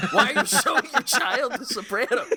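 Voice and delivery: high, loud, laughing through the scolding; laughing retold scolding. Actor scolding dad for showing kid Sopranos, laughing loud. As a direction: crack up while quoting the angry actor.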